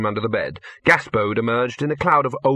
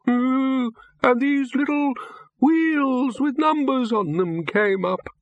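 put-on old man voice; 14 semitones higher, slower than usual. A narrator's reading. Old wizard Windlepoons explains.

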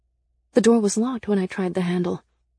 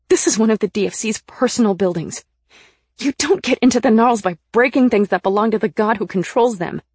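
loud, raised-pitch scolding; heated, insistent protest; urgent remonstration; 4 semitones higher, 7 dB louder than usual. A narrator's reading. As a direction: argue forcefully to stop him. Still shouting to stop Nick.

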